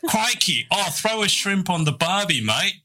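high, hammed-up Aussie accent. Australian host mocking Aussie clichés, exaggerated Australian accent. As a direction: parody the Aussie tourist-advert cliché. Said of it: "us Australians just go around going".

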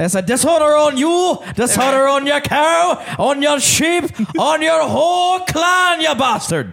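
loud, high, slow Scottish shouting curse; Scottish accent. Pretend. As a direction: bellow it like an angry Highlander. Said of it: "applause one time for that".

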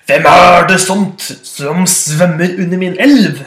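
roaring goat. Acted. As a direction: roar down from the bridge. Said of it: "brølte den store bukken Bruse". (langsomt brølende bukk)

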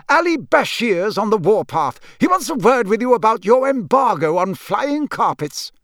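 high, loud, fussy official; brisk, officious bureaucrat. A narrator's reading. Senior official raising carpet embargo with Arthur, officious. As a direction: press your business on him officiously. Narrator plays Mister Crouch.

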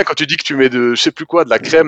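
usual speaking voice

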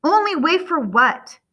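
high, loud bewildered question; alarmed confusion. A narrator's reading. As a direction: demand an explanation, pitch jumping. Confused son challenges locked door.

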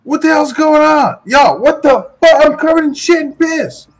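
outraged. Acted. Player's character covered in filth.

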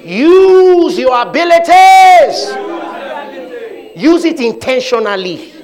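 high, loud, slow preaching cry. Acted. Preacher exhorting the congregation, loud and slow. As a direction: drive it home to the church.

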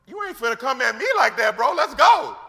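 loud, high, acted-out challenge to fight; imitating a heated street face-off; confrontational comeback. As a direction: square up and build to a shouted finish. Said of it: "So Peter came at him".

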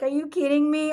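high, loud incredulous exclamation; emphatic, high-pitched disbelief; retold disbelief. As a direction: imitate friends loudly protesting an apology. Group reassures apologetic woman, retold.

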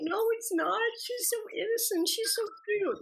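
high gushing about her younger self; fond gushing. Retold or imitated. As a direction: gush over the innocent girl. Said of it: "I'm like".

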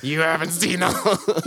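laughing through a villain threat; cracking up mid-threat; laughing villain line. Retold or imitated. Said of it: "What was that voice you were gonna use".

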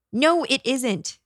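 high, drawn-out retort without real volume; a touch of exasperated yelling; mild yell. A narrator's reading. Man refuses the ghost boy, high mild yell. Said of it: "the man yelled".

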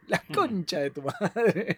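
slowed, laughing through the swear; can't hold the laugh while cursing; cracking up at Roshi watching a soap, laughing curse. Real, not acted. Cracks up at the telenovela scene.

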